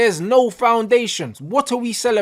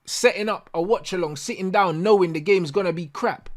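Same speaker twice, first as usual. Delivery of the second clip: usual speaking voice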